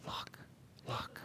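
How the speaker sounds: barely audible look, look; soft talker. Shown as a demonstration. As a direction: murmur it, almost nothing. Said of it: "he's not going".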